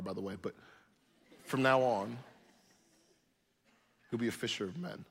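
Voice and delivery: a light, playful reassurance; gentle Jesus. Retold or imitated. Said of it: "he says".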